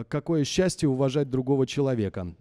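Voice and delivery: loud, quick recital of the Chekhov line; recited quote. Shown as a demonstration. Coach reciting Chekhov to the singer, loud and quick. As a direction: declaim it, fast and full-voiced. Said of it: "it's like a mysterious phrase".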